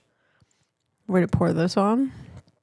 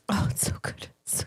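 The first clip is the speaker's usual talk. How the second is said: soft unvoiced whisper; 8 dB quieter than usual. Real, not acted. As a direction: whisper it while savoring. Savoring dessert, whispered.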